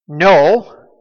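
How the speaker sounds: loud, emphatic acted no; emphatic denial. Preacher quoting Peter's denial, loud and emphatic. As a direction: retell a firm, loud denial. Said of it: "he just doesn't just say".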